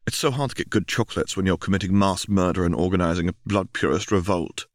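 usual speaking voice

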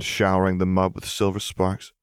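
usual speaking voice

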